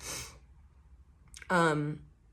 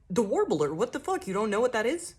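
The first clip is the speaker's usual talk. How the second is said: higher, louder mock disbelief; incredulous teasing, pitched up; ribbing incredulity; 5 semitones higher, 4 dB louder than usual. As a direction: rib the clueless newcomer. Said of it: "just ribbing you".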